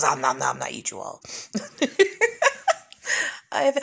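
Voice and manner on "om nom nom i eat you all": low rumbling shark voice; hungry shark. Retold or imitated. The shark devours everyone in a low voice. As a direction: growl it low like a greedy shark. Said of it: "the shark came and he goes".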